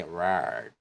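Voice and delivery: "rard" said with a rolled Irish r. Shown as a demonstration. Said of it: "some speakers may even say".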